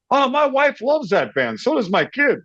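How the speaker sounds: high, gushing retold enthusiasm; excited fan. Owner learning he's facing wife's favorite band, excited. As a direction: gush it up high like a delighted fan.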